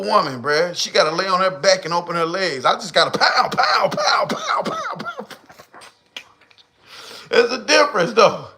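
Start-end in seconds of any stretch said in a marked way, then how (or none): 3.5-5.3 high, far-off pow pow pow fading out; mock gunshot pow. Commentator mocking a man's brag, high popping pows. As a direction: fire off little pows like shots.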